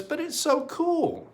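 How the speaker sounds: put-on very high, pleading kid voice; whiny kid. Youth pleads with mom to keep the tree, high kid voice. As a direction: squeak it like a sulky kid.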